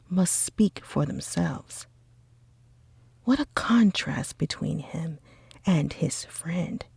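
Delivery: usual speaking voice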